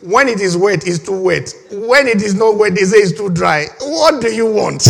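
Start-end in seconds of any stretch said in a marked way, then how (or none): 3.8-4.9 slow, laughing mock question; exasperated laughing question; laughing exasperation. Real, not acted. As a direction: laugh it out at the absurdity. Mocking the complainers, laughing.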